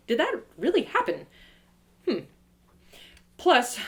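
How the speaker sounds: usual speaking voice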